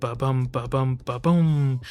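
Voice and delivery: "ba-bum" in pairs building to a loud "ba-boom"; weak "ba", strong "bum", with a big last "boom"; heartbeat rhythm. Shown as a demonstration. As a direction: pulse "ba-bum" evenly, then hit "ba-boom" harder. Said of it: "mirror that sort of ba-bum".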